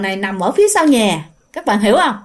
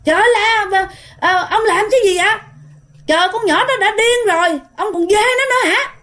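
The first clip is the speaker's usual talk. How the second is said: angry shout; 10 semitones higher than usual. Retold or imitated. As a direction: scold the monk at the top of your voice. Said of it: "bà ngoại la lên nha".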